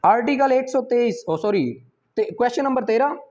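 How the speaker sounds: usual speaking voice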